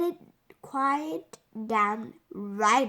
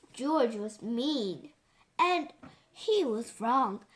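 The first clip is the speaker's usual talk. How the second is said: ordinary delivery, nothing marked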